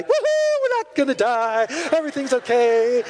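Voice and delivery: put-on high woohoo, gleeful little girl; excited whoop. Pastor imitates the girl cheering after takeoff, high and gleeful. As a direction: squeal it with childish glee. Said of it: "she's so excited about this".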